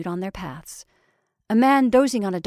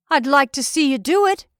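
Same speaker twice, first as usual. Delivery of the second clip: higher, louder defiant challenge; 5 semitones higher, 6 dB louder than usual. A narrator's reading. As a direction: dare them, voice raised. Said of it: "he said defiantly".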